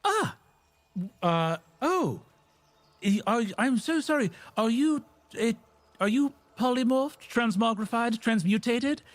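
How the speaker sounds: Guild Mage Morrow flustered. Acted. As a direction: fumble through the apology like a fussy wizard. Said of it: "Guild Mage Morrow turns and says".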